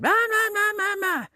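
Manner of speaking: very high, loud imitated child's voice; little girl calling. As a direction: call out like a little girl on her bike. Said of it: "the little girl got right there on her bike and went".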